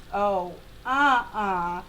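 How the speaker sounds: lightly voiced, slow balky car; car refusing. Little car refuses to keep going, slow mock refusal. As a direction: drag it out as a refusal. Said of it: "my little car was like".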